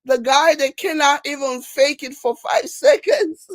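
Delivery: laughing through the words; breaking into laughter. Real, not acted. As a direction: start losing it with laughter.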